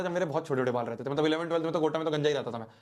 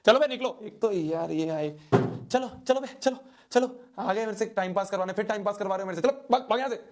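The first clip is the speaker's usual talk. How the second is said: higher, playful shooing of the viewers; mock shooing off; 5 semitones higher than usual. Vlogger jokingly chasing viewers off at the end, mock shooing. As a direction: cheekily chase the audience away. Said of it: "अब मैं तुम्हें भगाऊंगा".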